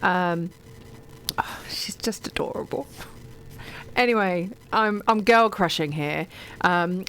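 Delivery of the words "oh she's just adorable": soft, whispered gush; adoring whisper. Real, not acted. Reader gushing over an author she loves, adoring whisper. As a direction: whisper it with fond admiration.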